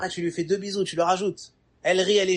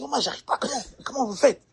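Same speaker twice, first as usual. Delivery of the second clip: shy helpless man; 8 semitones higher, faster than usual. Acted. Shy man asks how others do it.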